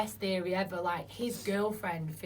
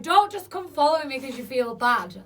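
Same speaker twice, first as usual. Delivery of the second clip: loud, higher retold telling-off; retold upset protest; 5 semitones higher, 10 dB louder than usual. Mum to the son following her up the street, upset and loud. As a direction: protest loudly, as if retelling a row.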